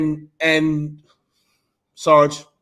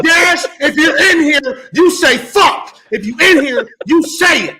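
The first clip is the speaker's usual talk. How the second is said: shouting; 10 semitones higher, 8 dB louder, slower than usual. Real, not acted. Yelling at friend to cuss.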